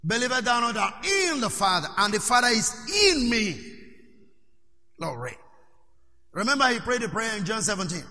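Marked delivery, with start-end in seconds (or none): 0.1-3.6 long, emphatic "in" each time; stress on "in". Shown as a demonstration. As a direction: punch both "in"s to drive the point home. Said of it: "The emphasis is the word "in".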